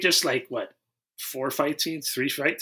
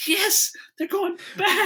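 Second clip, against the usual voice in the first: high, laughing cheer; excited; slower than usual. Retold or imitated. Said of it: "and I was like".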